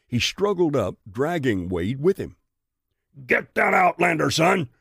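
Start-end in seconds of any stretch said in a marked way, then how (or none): 3.3-4.7 high, shouted command; bellow. A narrator's reading. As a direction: bellow it across the fight. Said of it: "Bristol bellowed".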